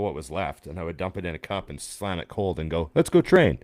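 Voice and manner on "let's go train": hyped, ready to hit the gym; pumped up. Retold or imitated. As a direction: bark it like a rallying cry. Swigs cold coffee, heads out.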